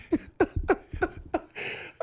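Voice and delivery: real laughing fit; big laugh. Real, not acted. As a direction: crack up at the memory. Laughing at own story.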